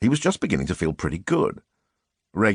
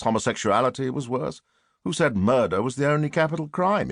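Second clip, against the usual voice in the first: ordinary delivery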